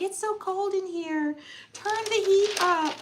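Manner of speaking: high, pleading whine; mock shivering whine, high; whiny complaint. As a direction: beg someone to turn up the heat. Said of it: "I'm always like".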